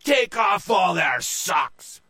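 slow, loud, high shout; drawn-out captain's command; battle cry. Acted. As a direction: holler the order over the fight. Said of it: "Joe cried out".

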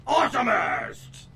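slow, high booming announcer shout; monster truck announcer. Acted. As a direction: bellow it like a truck rally ad. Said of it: "I told you".

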